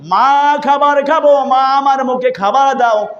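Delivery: high sing-song sermon chant; melodic preaching. Retold or imitated. Preacher retelling Omar questioning a mother, melodic preaching. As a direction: chant the retold dialogue high, with drawn-out line ends.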